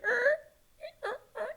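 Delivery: high strained creak; creaky couch noise. Retold or imitated. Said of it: "he'd be like".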